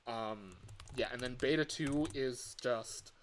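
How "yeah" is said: ordinary talk, nothing marked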